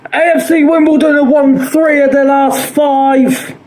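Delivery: normal talking voice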